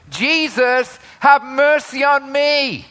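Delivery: high, loud desperate plea; yelling. Acted. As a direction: shout to be found in a crowd. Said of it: "He's yelling".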